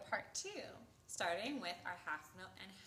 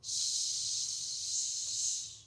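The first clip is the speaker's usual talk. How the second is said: long, loud, steady shush; sustained "shhh"; rhythm rest shush; 6 dB louder than usual. Shown as a demonstration. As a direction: hold a hush for the full rest. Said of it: "all of the rhythm cards".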